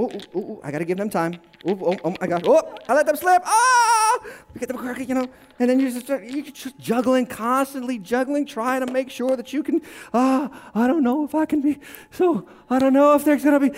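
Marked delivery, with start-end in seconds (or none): 0.0-4.2 juggler losing control, hammed-up; mock panic. Person juggling everyone's expectations, mock panic. Acting out frantic juggling of people. 10.1-13.7 mock anxiety. Still acting the anxious juggler.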